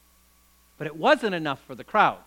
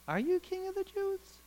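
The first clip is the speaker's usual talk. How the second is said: higher, softer, doubtful question; quizzical; 12 semitones higher, 7 dB quieter than usual. Acted. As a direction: ask it with a raised eyebrow. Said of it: "raised a quizzical eye upon Jesus".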